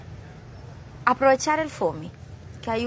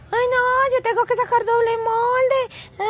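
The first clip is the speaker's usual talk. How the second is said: high, teary mock whine; dramatic whiny student; 13 semitones higher than usual. Mocking a fussy student, high-pitched and teary. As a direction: ham up a crafter who is crying over a double template. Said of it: "qué drama".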